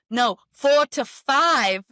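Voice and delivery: put-on doula's South African accent, slow and high; drawn-out South African "four to five". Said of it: "she's got her South African accent".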